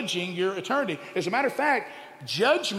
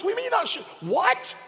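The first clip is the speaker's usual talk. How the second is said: shrill disbelief, much higher; can't believe what he hears; incredulous; 12 semitones higher than usual. Retold or imitated. Preacher scoffing at women unsure about porn, much higher.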